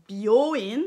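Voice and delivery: "byoin" loud, holding the O; "byoin" as "bi-yooo-ine", long middle vowel; lengthened O. Shown as a demonstration. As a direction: draw out the O in "byoin". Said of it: "vous faites un O allongé".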